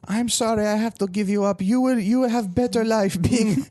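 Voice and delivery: mock Chinese accent, off the cuff and botched; bad Chinese accent. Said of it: "This is not a good Chinese accent".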